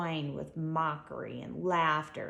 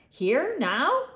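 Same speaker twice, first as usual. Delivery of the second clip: higher, louder puzzled question; confused; 8 semitones higher, 8 dB louder than usual. A narrator's reading. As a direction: ask it bewildered. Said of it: "Confused, Ludwig asks".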